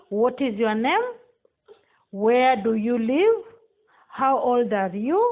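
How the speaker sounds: ordinary talk, nothing marked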